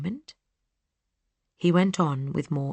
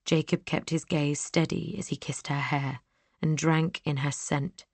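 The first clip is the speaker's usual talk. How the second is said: ordinary delivery, nothing marked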